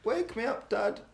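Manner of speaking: put-on high, slow plaintive child voice; hammed-up little voice pleading for Dad; mock frightened child. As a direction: play a kid calling out from a nightmare. Acting child waking from sausage nightmare.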